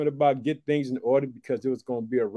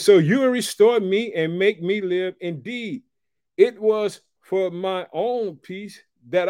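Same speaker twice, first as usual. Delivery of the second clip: raised, preached scripture reading; 6 semitones higher, 3 dB louder than usual. Acted. Preacher reads Hezekiah's lament to the congregation, raised and preached. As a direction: read it out like a lament from the pulpit.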